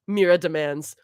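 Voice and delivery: plain reading voice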